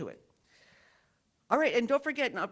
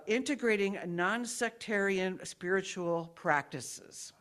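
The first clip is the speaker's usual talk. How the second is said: ordinary talk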